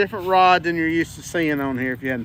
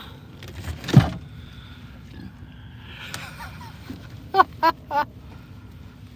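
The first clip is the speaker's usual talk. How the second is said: high breathy laugh; 4 dB quieter than usual. Real, not acted. Laughs after landing fish.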